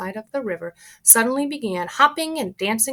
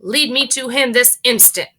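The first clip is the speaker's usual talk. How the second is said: loud commanding demand; demanding mother; 5 semitones higher, 7 dB louder than usual. Acted. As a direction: order him now. Mrs Gloop demands.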